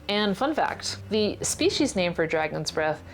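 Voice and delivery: ordinary delivery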